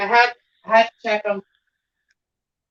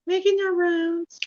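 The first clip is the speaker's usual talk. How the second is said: higher, softer, slower pet talk; cooing to a cat; 7 semitones higher, 6 dB quieter than usual. Real, not acted. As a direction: coo it sweetly to the cat. Coos to cat Hildy.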